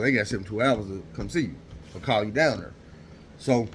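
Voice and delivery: ordinary talk, nothing marked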